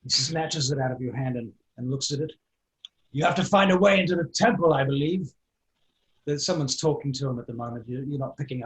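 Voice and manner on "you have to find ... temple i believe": loud, raised-pitch character voice; advising tavern man. Acted. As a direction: instruct a newcomer as the blustering man.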